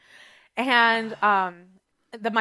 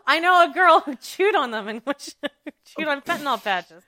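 higher, laughing through it; laughing confession; 6 semitones higher, 5 dB louder than usual. Retold or imitated. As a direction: giggle out the confession. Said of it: "I'm like".

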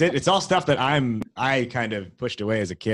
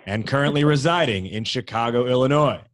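big arena announcer build-up; ring announcer; 4 semitones higher, 4 dB louder than usual. Acted. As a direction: announce her like a prizefighter. Boxing-style guest introduction.

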